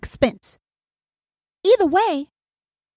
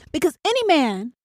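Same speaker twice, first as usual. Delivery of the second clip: ordinary delivery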